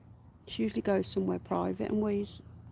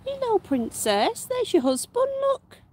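cooing to rabbit; 14 semitones higher, 4 dB louder than usual. Real, not acted. Owner gushing to her rabbit in high baby talk. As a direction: gush at the bunny.

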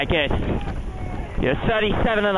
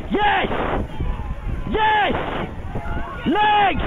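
excited shouting; 9 semitones higher, much slower than usual. Real, not acted. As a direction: roar them on, thrilled. Cox urging crew past rivals.

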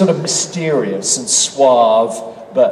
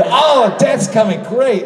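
high, raised-voice mock enthusiasm; excited fan; 7 semitones higher, slower than usual. Actor imitating fans awaiting his character, excitedly. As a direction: gush like a thrilled fan, pitched up. Acting out excited fans' remarks.

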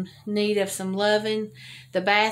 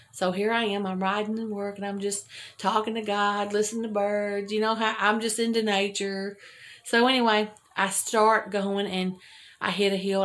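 ordinary talk

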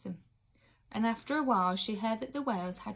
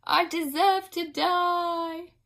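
high, loud, slow anguished moan; a guilt-stricken nurse moaning slowly; 9 semitones higher, 8 dB louder, much slower than usual. A narrator's reading. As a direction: moan it out in self-pity. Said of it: "moaned a third".

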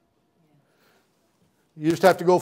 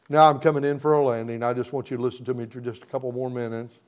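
ordinary delivery

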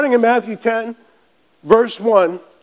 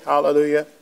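ordinary talk

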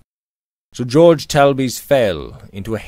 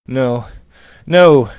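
crying out; 4 semitones higher, 8 dB louder than usual. A narrator's reading. George rejects his friend's comfort, crying out. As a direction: protest building from firm to loud. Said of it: "he cried".

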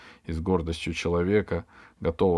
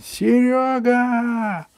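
even higher, louder, slow drawn-out yell; slow shouted call, much higher; 6 dB louder, much slower than usual. Acted. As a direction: bellow the name down from the window. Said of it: "Джонни завопил".

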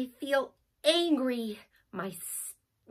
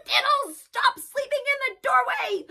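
much higher cross shout at the cat; high, louder telling off; angry yell; 11 semitones higher, 3 dB louder than usual. Acted. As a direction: scold the cat. Child yells at cat.

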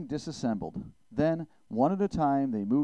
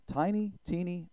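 plain reading voice

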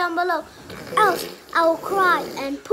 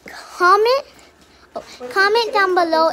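high, loud shout of "Comment"; sudden loud higher cry; loud call out; 3 semitones higher, 6 dB louder than usual. Real, not acted. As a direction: blurt it out to the viewers. Calling out to viewers.

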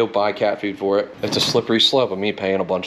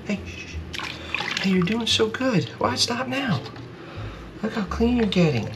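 much higher, sing-song cooing to a pet; 12 semitones higher than usual. Real, not acted. Man soothing a wet stray cat during its bath, high baby talk.

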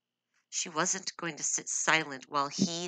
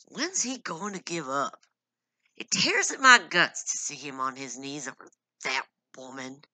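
through gritted teeth; 6 dB louder than usual. A narrator's reading. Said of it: "Paul spoke through gritted teeth".

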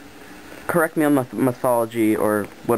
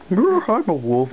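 slow, higher wolf role; 6 semitones higher, slower than usual. Pretend. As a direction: drawl it like a proud wolf. Playing a wolf character.

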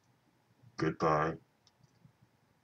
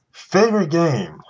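high, loud announcer-like question; loud mock question; 10 semitones higher, 10 dB louder than usual. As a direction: belt the question out. Reads viewer question.

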